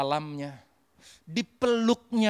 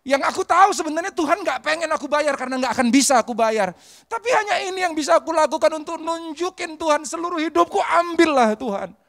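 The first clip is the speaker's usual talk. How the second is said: high, loud, pleading prayer; impassioned plea; 7 semitones higher, 5 dB louder than usual. Retold or imitated. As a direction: act out a fervent offering of oneself to God. Acted plea of the woman to God.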